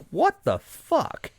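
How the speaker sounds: high, slow, drawn-out what the fuck; opening a fifteen hundred dollar bill, stunned and high; shocked disbelief. Retold or imitated. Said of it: "I'm like".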